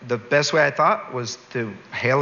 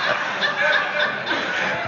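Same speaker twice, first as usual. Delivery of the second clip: high squeaky laugh. Real, not acted. Laughs at restraining order joke.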